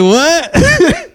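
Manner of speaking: high, loud, startled what; mock surprise. Recalling hearing a loyalty chant, surprised. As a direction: act stunned by the lyric. Reacting to punk song.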